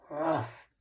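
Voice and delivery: low, short ruff; a brief low doggy bark; dog's bark. A narrator's reading. Teacher reading a farm story to children, dog's bark. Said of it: "said the dog".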